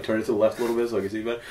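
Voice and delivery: quick, soft imitation of a fussy viewer; imitating her. Host mimicking cohost asking to turn the shoe, quickly. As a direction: rattle it off like she would. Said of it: "I wanna hear her say, like".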